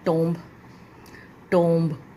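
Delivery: टोम्ब with long o and sounded b; common mispronunciation of tomb. Shown as a demonstration. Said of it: "mostly आप लोग करते हो".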